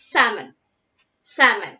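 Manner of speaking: "salmon" as SAM-un, no L; silent L. Shown as a demonstration. Teacher showing the class how to pronounce it, silent L in "salmon". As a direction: drop the L from "salmon". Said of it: "we have to pronounce this word as".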